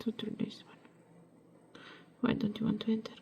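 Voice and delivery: soft unvoiced whisper to herself. Real, not acted. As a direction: murmur it close to the work, breathy. Coaxing a stubborn bead.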